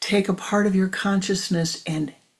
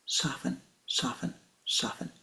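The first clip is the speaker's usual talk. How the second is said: much softer, fading almost to a whisper; softening; 4 semitones lower, 8 dB quieter than usual. Shown as a demonstration. Teacher showing how to soften, fading almost to a whisper. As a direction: ease off into near silence.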